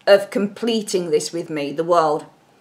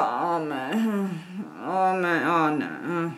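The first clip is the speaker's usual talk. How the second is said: hesitant sighing groans; 5 semitones lower, 9 dB quieter, much slower than usual. Retold or imitated. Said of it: "he's like".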